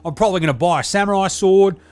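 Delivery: a brash, sweary ocker lad; Deli character. Acted.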